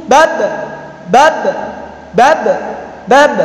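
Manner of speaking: "bab" with the final b stopped, short echo; held sound. Shown as a demonstration. Teacher drilling bab tertahan, held sound. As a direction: close off the last b of "bab". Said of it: "bab tertahan".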